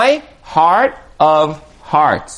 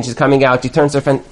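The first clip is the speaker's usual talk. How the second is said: ordinary talk, nothing marked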